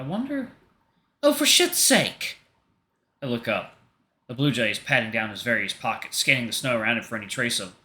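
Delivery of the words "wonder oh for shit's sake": character snapping in sudden irritation; exasperated outburst. Acted. Artemis realizing his wallet is gone, exasperated high outburst.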